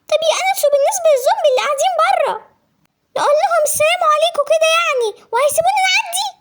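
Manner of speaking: a scared younger sister demanding an answer; anxious little sister. Acted. Voices sister Anisa.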